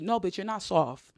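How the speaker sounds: usual speaking voice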